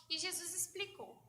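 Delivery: usual speaking voice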